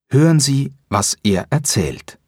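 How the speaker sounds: ordinary delivery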